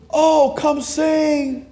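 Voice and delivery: very high, very slow gushing plea; mock pleading invitation. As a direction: draw it out high like churchgoers begging. Congregation urging aunt to sing.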